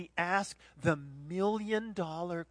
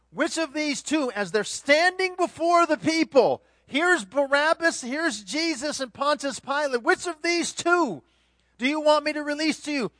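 raised, high, loud preaching voice as Pilate; loud, pitched-up Pilate questioning the crowd; Pilate addressing crowd; 9 semitones higher, 7 dB louder than usual. Acted. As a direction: call it out to the mob.

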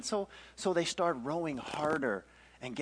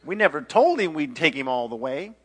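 loud, indignant mock argument; acted heated protest; protesting sailor; 6 semitones higher, 11 dB louder than usual. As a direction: justify it loudly to your shipmates. Sailors argue over their passenger.

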